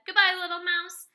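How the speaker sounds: high, loud, hurried farewell; acted panicky shout; panicked fox. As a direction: call it out in a fright. Fox flees.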